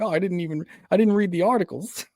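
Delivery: high, mock-whiny excuse; defensive boyfriend. Boyfriend excusing himself to his girlfriend, high mock defensiveness.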